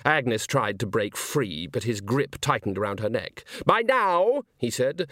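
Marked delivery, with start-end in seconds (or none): 3.6-4.5 theatrical impatience. Acted. Villain lectures his audience.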